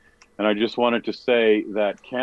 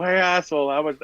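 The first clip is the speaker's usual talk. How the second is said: mock yell; 7 semitones higher than usual. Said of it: "you're yelling it as loud as you can".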